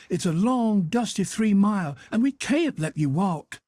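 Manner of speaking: a lady coaxing a guest to stay; fussing Aunt Sally. A narrator's reading. Aunt Sally presses hospitality.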